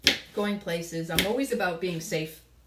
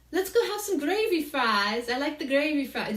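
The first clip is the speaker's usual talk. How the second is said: imitating an Aussie friend's Australian accent, higher and louder; a bright put-on Australian accent, pitched up; 8 semitones higher, 5 dB louder than usual. Retelling an Aussie visitor asking for gravy fries in an Australian accent. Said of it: "the way he said it was just so cool".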